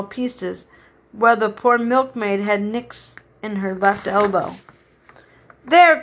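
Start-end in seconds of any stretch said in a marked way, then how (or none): 5.6-6.0 loud, high, cross exclamation; indignant outburst; angry cry. A narrator's reading. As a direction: cry it out as an annoyed milkmaid. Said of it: "cried the milkmaid angrily".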